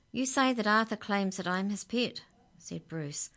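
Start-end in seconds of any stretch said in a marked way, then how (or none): 0.1-2.2 high voice, a little steadier; little bird regaining composure; steadier budgie. A narrator's reading. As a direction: make the case more calmly, still high and loud. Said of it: "a little more steadily now".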